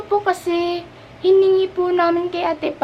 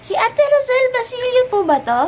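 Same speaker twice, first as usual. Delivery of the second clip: put-on fan girl voice; 8 semitones higher than usual. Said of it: "fan niya ako".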